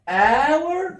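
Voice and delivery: اور sung out high and long; stretched ow. Shown as a demonstration. Teacher blending فلا and اور into flower. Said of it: "فلا".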